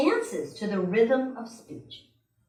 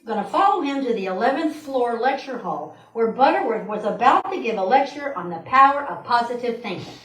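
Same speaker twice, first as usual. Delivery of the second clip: ordinary talk, nothing marked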